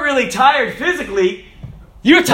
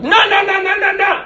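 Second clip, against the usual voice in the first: high-pitched mock yell; rapid-fire nos, mimicking an angry yeller; imitated yelling; 11 semitones higher than usual. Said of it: "There's a guy yelling at me".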